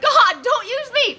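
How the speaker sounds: a loud, slow, high-pitched plea; pleading cry. Retold or imitated. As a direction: beg God not to use you, drawn out. Retold plea to God.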